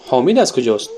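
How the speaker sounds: normal talking voice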